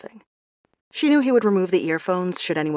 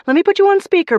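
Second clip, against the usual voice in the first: high, loud into the phone; 13 semitones higher, 10 dB louder than usual. A narrator's reading. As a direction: call it out to the phone. Talking into a phone.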